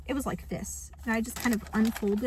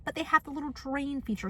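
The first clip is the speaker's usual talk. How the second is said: ordinary talk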